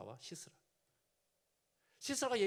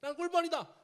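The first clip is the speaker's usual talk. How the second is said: mock proud shout; 9 semitones higher, 6 dB louder than usual. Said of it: "하필 꿀벌입니다".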